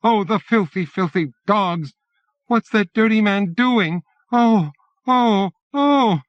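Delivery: put-on a little boy shrieking in horror; squealing child. A narrator's reading. Small boy squealing as his sister is attacked. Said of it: "Little Danny squeals".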